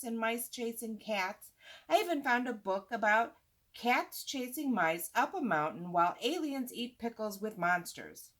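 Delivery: ordinary delivery, nothing marked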